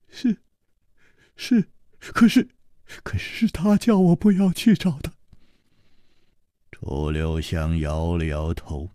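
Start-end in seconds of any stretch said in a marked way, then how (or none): 0.1-5.1 higher, stammering servant's voice; the servant stammering his shame, higher voice; timid young servant. Acted. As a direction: falter out the words, ashamed. Yijian hesitates.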